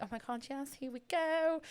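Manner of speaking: rising, thrilled little cheer, pitch jumping up on go; imitating the buzz as the dealer arrives; mock party excitement. Sam recalling the old thrill of a fix, excited. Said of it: "you have a little party".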